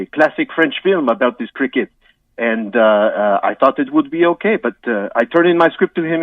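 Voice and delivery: hammed-up French accent, halting with uhs. Guest apologises to the host in a mock French accent. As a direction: play a comic Frenchman stumbling through English.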